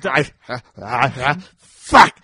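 hammed-up cry of agony; mock agonized yell. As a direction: yell it like a badly injured man. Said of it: "groaning and trying to form a sentence".

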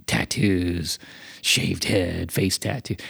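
low, quiet judgmental grumble; mock disgust. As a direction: size someone up with quiet distaste. Acted disdain for tattooed neighbor.

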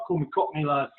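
usual speaking voice

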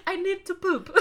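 put-on high, laughing French accent; French poodle accent. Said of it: "that poodle should not have an accent".

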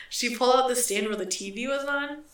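ordinary delivery

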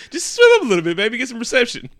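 high, loud, laughing coax; mock sweet coaxing. Coaxing Ken to swim up, high mock sweetness. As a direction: sweet-talk him like a baby. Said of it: "I'd be like".

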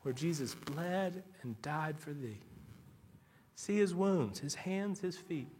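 quiet reverent recitation; hushed reverence. Acted. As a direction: let the words sink in tenderly. Quoting a hymn reverently.

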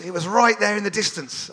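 ordinary talk, nothing marked

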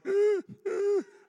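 sharp wheezy gasp for air; struggling asthmatic gasp. Shown as a demonstration. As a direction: gasp like you can't breathe. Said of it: "everywhere you walk is like".

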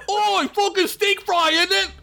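high mock yell of an elf; a hammed-up elf sneering at fries; yelling merc elf. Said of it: "yelled a merc".

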